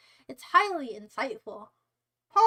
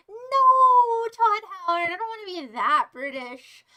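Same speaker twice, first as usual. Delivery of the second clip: high, loud mock-despair no; mock wail; 5 semitones higher, 10 dB louder than usual. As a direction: protest like it's a tragedy. Doesn't want to be British.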